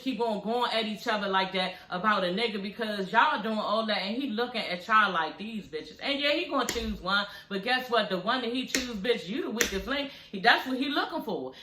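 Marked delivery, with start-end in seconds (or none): 5.4-6.0 much softer, lower scornful aside; imitating a man's quiet contempt; dismissive. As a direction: mutter it like a man unimpressed by the women. Man eyeing women who fight.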